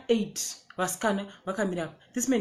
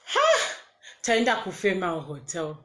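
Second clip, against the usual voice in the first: ordinary delivery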